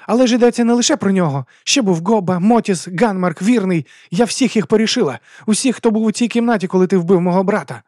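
high, loud, vengeful reckoning; furious tirade. A narrator's reading. Woman lists her victims to her brother's killer, furiously. Месниця перелічує вбитих герцогові.

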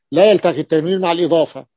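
usual speaking voice

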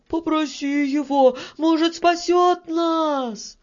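very high pleading shout; high little-man voice, calling out desperately; panicked cry. A narrator's reading. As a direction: cry for help at the top of your range. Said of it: "закричал мужичок с бородой вроде как пакля".

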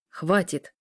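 usual speaking voice

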